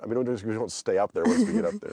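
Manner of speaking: usual speaking voice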